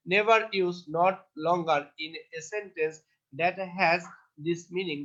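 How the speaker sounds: very slow, word by word; slow dictation. Shown as a demonstration. Teacher dictating the rule slowly to students. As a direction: read the rule slowly for notes. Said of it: "teacher dictates rule slowly".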